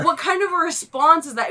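high, incredulous protest; exasperated outburst; indignant. Real, not acted. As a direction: vent disbelief at an absurd reply. Indignant at waitress's reply.

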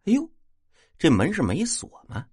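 音调抬高，假装吃了一惊; 装作意外地发问; 故作惊奇. A narrator's reading. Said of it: "我故作惊奇地说". (故作惊讶)

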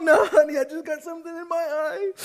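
high, loud laughing excuse; husband denying tears through laughter; laughing denial. Retold or imitated. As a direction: brush it off while laughing. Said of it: "And I'm like".

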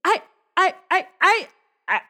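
high, loud, panicky stammering; stammering "what, me?" in high alarm; flustered stammer. Acted. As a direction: point at yourself, caught out. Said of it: "I'll point at myself like".

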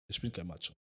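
drops to a mumble; trailing off. Real, not acted. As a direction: Ich bin kein Macho, almost swallowed. Leise Wiederholung. (leise auslaufend)

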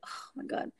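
whispered oh my God; hushed dread; whispered panic. Retold or imitated. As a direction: breathe it out in a whisper. Panicking alone by the truck.

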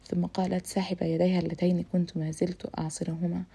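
plain reading voice

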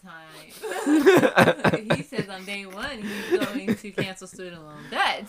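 laughing, slow and loud. Real, not acted. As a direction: laugh through the memory. Laughing about Bernie.

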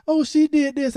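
mock crying kid. Dad mimics his crying daughter tattling. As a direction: imitate the tattling child. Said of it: "come in there crying and saying".

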